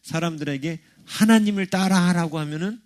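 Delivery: normal talking voice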